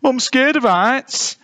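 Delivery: high, slow, timid voice; high-pitched nervous bear; scared bear. A narrator's reading. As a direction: plead high and frightened. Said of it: "replied the one with the biggest".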